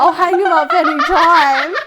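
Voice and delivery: loud, high, cracking up through the quote; laughing hard. Real, not acted. Friend retells his pot-hanging offer, laughing hard. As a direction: barely get it out for laughing.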